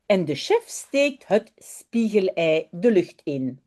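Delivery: expressive read-aloud with voiced characters; storybook narration, lively; children's story reading. A narrator's reading. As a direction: read it to a class of little ones.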